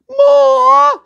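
high, loud crying shriek; shrieking demand. A narrator's reading. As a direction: screech it out greedily. Sisters demanding more ripping.

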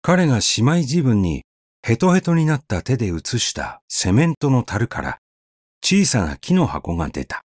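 ordinary delivery, nothing marked

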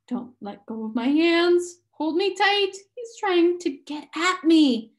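put-on a small boy clinging in fear; panicked. A narrator's reading. Charles Wallace begging Meg to hold tight, panicky. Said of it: "his voice held panic".

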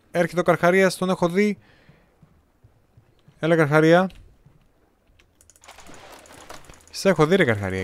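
loud, high call to the shark; taunting the shark loudly. Real, not acted. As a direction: shout out for the shark. Calling out to shark in game.

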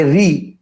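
"রি" as a lone syllable; broken down by sound. Shown as a demonstration. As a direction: isolate "রি", a plain ri. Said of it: "উচ্চারণ অনুসারে ভাঙি".

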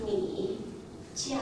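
你 said with a low dip, 叫 sharply falling; teacher modelling the Chinese tones. Shown as a demonstration. Teacher models 你 叫 for students, careful Mandarin tones. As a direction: say 你 then 叫 slowly for the class. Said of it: "we will say".

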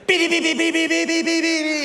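loud, high mimicked car horn; car horn honking. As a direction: blare it like an impatient driver's horn. Impatient driver honking at semaphore. (bocina de auto imitada, fuerte y aguda)

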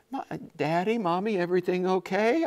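put-on high, timid little kid asking; a worried three-year-old; small child voice.

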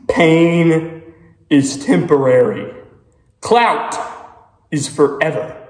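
loud pep-talk chant; hyped-up shout. Real, not acted. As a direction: psych yourself up loudly. Hype chant before spicy challenge.

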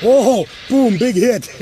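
excited cheer. Real, not acted. Ghost hunter cheering as the spirit device lights up, excited shout. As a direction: shout it out like you scored.

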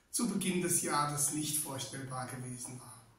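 ordinary delivery

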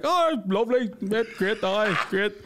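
high, awkwardly agreeable dad voice; mock polite small talk; imitated dad. Retold dad humouring supposed English stranger.

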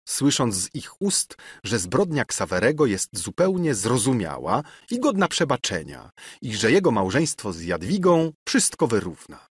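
ordinary delivery, nothing marked

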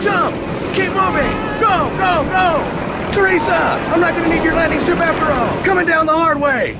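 high, loud urgent yelling; frantic commander shouting everyone out; shouting over chaos. Acted. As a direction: bark orders over the roar of a crash. Escaping a crashing plane.

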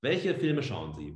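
usual speaking voice

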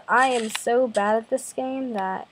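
usual speaking voice

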